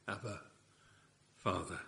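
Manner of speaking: soft, intimate call to a father; hushed tender cry. Acted. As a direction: breathe it like a child to its father.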